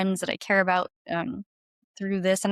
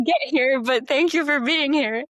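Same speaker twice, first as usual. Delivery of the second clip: high, louder giddy mock reply; giddy thanks; 6 semitones higher, 5 dB louder than usual. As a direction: gush playfully at the fan. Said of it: "I was like".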